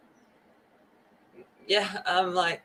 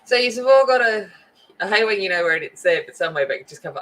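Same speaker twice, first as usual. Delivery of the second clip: normal talking voice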